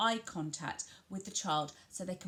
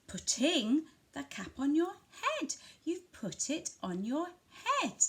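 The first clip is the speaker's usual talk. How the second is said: higher pitch, PUTTING punched and set apart; stress on PUTTING; 7 semitones higher than usual. Shown as a demonstration. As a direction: commentate to a child, leaning on putting. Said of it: "you're emphasising what they're doing".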